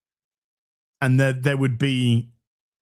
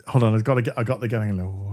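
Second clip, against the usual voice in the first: ordinary talk, nothing marked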